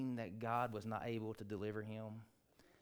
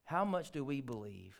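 ordinary talk, nothing marked

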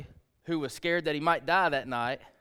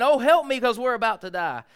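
mock panic; 7 semitones higher, 8 dB louder than usual. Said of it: "running to him and saying".

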